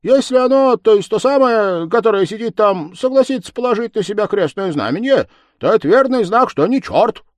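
high, loud, eager reasoning; clerk's voice. Acted. Clerk proposes a test for the devil, high and insistent.

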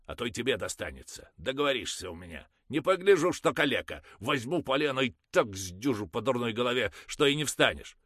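fast, rising to loud; fast angry threat. Acted. Mother threatening the crippled father, loud. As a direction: threaten him hotly.

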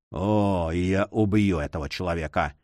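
low, dull-voiced threat; muffled menacing mutter. A narrator's reading. Captain vowing to kill the abductor, muffled mutter. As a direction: sink the voice to a dark mutter. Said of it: "глухим голосом пробормотал".

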